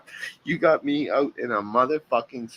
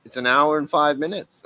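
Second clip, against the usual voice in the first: ordinary talk, nothing marked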